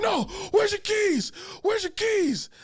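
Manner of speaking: high-pitched yelled imitation of frantic friend; agitated demand. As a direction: demand it urgently, voice way up. Acts out agitated friend demanding keys.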